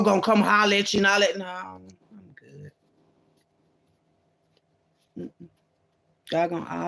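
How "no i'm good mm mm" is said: breathy whisper, slow; whispered refusal. Retold or imitated. Turning down the bro who comes hollering, whispered.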